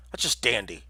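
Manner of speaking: usual speaking voice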